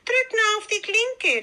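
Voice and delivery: very high grandmotherly call. A narrator's reading. Grandmother calling to her visitor from bed, calling out. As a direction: call through to the door in a high voice. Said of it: "rief die Großmutter".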